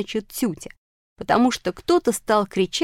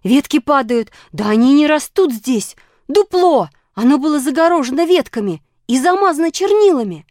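high, loud, mounting cries; raised voice, climbing with excitement; excited shouting; 8 semitones higher, 8 dB louder than usual. A narrator's reading. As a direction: shout the discovery down to friends. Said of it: "закричала буква".

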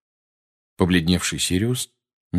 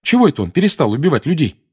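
eager fast question; 8 semitones higher, 5 dB louder, faster than usual. Acted. Harry asks.

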